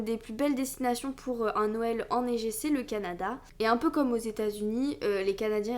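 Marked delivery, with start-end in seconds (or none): none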